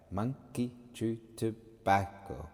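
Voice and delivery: "monkey chew tobacco" slowly, a gap between syllables. Shown as a demonstration. Teacher dividing the words to count subdivisions, slow and separated syllables. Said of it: "just logically dividing those words".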